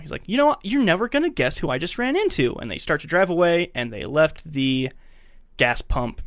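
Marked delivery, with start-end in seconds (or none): 0.3-2.5 bursting with news, voice jumps up; can't wait to spill the gossip; excited news. Retold or imitated. Eugene tells his friend about a run-in, excitedly.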